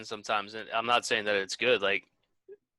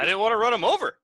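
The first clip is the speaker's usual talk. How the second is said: higher and louder, playing the annoyed cop; mock cop voice; 8 semitones higher, 6 dB louder, faster than usual. Friend mimicking the cop, a higher, louder mock cop voice. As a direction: toss it off like a testy officer.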